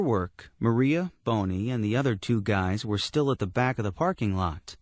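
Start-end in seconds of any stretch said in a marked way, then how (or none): none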